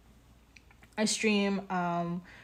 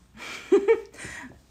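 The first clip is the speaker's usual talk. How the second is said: high giggle; 12 semitones higher, 7 dB louder than usual. Real, not acted. Giggling while streaming.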